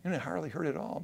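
gentle dentist reassuring a nervous patient; concerned dentist. Retold or imitated. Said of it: "they're so concerned".

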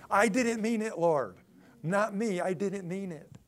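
loud, high begging; panicked pleading. Acted. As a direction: plead loudly to be spared. Worker pleads after layoff notices.

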